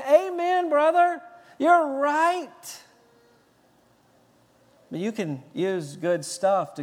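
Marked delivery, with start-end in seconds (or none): 0.1-2.8 higher, eager voice of an approving disciple; agreeing disciples. Retold or imitated. Disciples cheering on Judas, eagerly agreeing. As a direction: play the nodding yes-man.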